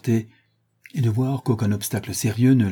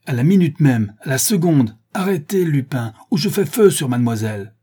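loud, higher, hammering each phrase; furious threat; 5 semitones higher, 5 dB louder than usual. A narrator's reading. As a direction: threaten at gunpoint with fury. Sholmes menace, revolver braqué. (menace furieuse, Sholmes braque son revolver)

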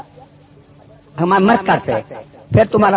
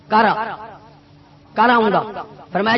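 ordinary delivery

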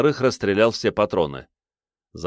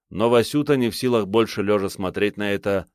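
ordinary delivery, nothing marked

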